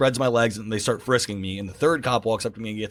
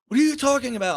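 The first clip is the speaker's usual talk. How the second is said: high-pitched mock bewilderment; acted incredulous protest; 12 semitones higher, faster than usual. Taxi driver protesting to his fleeing passenger, high incredulity. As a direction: imitate a baffled driver, voice shooting up.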